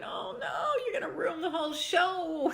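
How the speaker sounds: high, hammed-up wail of despair; mock wailing dismay. Own reaction to crying baby, retold.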